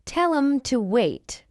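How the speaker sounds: slow, measured "Tell 'em to wait"; slow model. Shown as a demonstration. Teacher models the phrase for learners first, slowly. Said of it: "fast English is not difficult".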